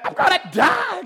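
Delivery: mock-dying wail, high and loud; whiny sick baby. Sick dad whining to his kids from bed, mock-dying wail. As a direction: ham up being a helpless sick baby. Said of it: "I'm a baby".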